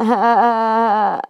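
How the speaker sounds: loud honking Janice laugh. Retold or imitated. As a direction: cackle like Janice from Friends. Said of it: "even I can do the Janice laugh".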